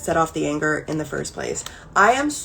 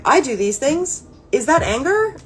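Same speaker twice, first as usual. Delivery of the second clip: dawning realization; 6 semitones higher than usual. Retold or imitated. Retelling her dawning realization.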